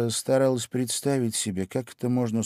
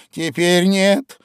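rueful; 10 semitones higher, 11 dB louder, slower than usual. A narrator's reading. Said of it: "сокрушенно покачав головой".

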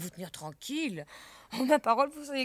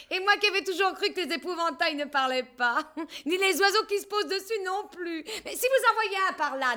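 laughing as she talks; 6 semitones higher, 6 dB louder than usual. Acted. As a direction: talk through the laughter. She laughs through the line.

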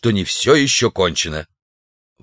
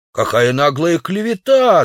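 indignant shout; 7 semitones higher than usual. A narrator's reading. Clothed Udalov shouting at the savage, high indignant cry. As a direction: shout down the slander. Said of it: "закричал одетый удалов".

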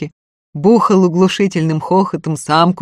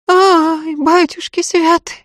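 wailing; 11 semitones higher, slower than usual. Acted. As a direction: wail it out in shock. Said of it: "в два голоса заголосили".

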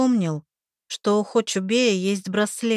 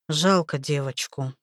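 sad; 5 semitones lower than usual. Acted. Makar, sad, low.

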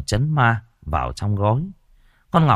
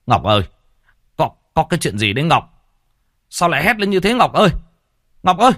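father calling anxiously through a locked door, louder and higher; 8 semitones higher, 5 dB louder, faster than usual. Acted. Father shouting to daughter behind door, worried loud call. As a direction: call her name urgently, rising. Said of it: "Bác gọi to".

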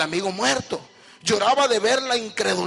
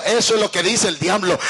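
ordinary talk

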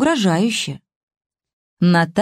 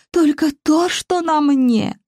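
put-on higher, slow, sobbing old woman; sobbing wail; 8 semitones higher, slower than usual. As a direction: wail it out through tears. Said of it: "Старуха рыдала басом".

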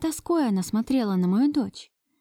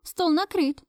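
Ksyusha calling; 9 semitones higher, slower than usual. A narrator's reading. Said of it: "раздался голос Ксюши".

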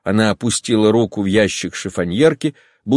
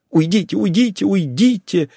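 higher, pained cry of a wife; Долли, крик от боли: уйдите; anguished cry; 10 semitones higher than usual. A narrator's reading. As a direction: cry out as if in physical pain. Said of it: "вскрикнула она".

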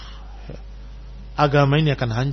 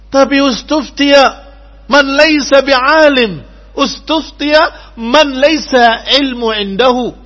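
slow, loud, higher recitation; Arabic quotation; 8 semitones higher, 9 dB louder, slower than usual. Acted. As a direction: declaim slowly with force. Quoting Rabi'ah's Arabic answer.

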